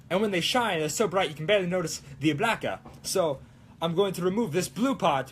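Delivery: mock Italian accent, lively and sing-song; put-on Italian accent. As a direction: keep up a playful Italian accent. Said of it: "speak in this accent".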